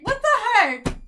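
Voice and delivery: loud, high-pitched cry of disbelief; astonished. Real, not acted. Reader stunned by another matching message, loud high astonishment. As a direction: blurt it out in real amazement.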